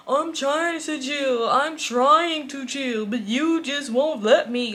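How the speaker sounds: high, slow, drawn-out whine; mock whiny protest. Friend protesting being told to chill, mock whiny. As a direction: imitate her whining, every word stretched.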